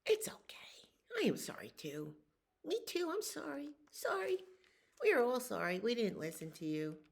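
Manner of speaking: high, whispered little frogs; sweet tiny apologies from the frogs. Acted. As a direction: frogs make up with the dragon, high.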